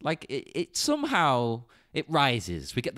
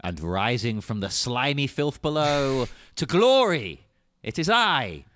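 grand, slow, louder wizard proclamation; 4 dB louder, slower than usual. Acted. Host introduces himself as Wizard of Foon, mock-grand wizard voice. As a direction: announce yourself like a wizard rising to glory. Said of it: "keep the wizard voice going".

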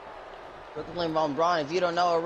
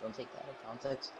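much softer, quick joking aside; quiet aside; 7 semitones lower, 14 dB quieter, faster than usual. Real, not acted. Streamer joking to viewers, quick and quiet. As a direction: mutter it fast off-mic. Softly jokes to audience.

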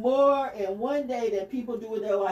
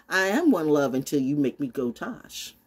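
ordinary talk

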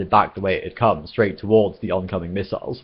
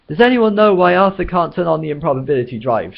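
higher, louder shout; 9 semitones higher, 5 dB louder than usual. Acted. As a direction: shout the question to everyone. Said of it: "shouted Trillian".

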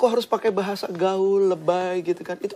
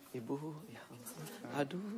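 put-on much softer, slow and respectful, as to an older woman; soft respectful address to mothers; gentle to mother; 2 semitones lower, 20 dB quieter, slower than usual. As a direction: coo it tenderly to a mother in the crowd. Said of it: "Kepada ibu pasti".

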